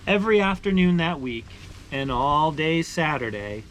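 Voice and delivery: steady, expressive read-aloud voice; story narration. Acted. Reading a maple sugaring picture book aloud to viewers, storytelling voice. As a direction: tell it like a picture book for kids.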